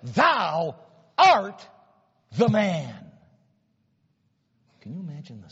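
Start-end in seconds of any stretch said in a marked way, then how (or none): 0.0-3.1 loud, rising on art; thundering accusation. Acted. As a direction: point the finger and boom it out. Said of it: "he preaches one of the shortest yet one of the most powerful messages".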